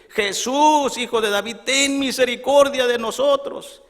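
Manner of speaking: loud, high-pitched cry for mercy; acted full-voiced calling out. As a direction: shout to Jesus over a crowd, imploring. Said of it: "vamos a gritar más fuerte".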